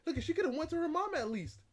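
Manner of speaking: loud, high, heated exclamation; loud pleading frustration; exasperated outburst. Real, not acted. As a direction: burst out in frustration. Rant continues, exasperated.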